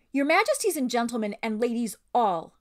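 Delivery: high, loud gallant proclamation; Reepicheep. A narrator's reading. Reader voices a bold talking mouse addressing royalty, proud and high. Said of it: "read that like Reepicheep".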